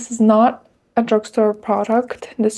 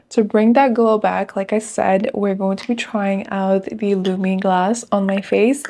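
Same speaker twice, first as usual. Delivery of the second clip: normal talking voice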